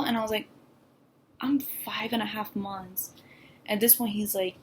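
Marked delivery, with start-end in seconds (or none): 1.4-3.1 hushed, voiceless confession; breathed out in a whisper. Retold or imitated. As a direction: whisper the bad news to her brother. Telling brother the bad news.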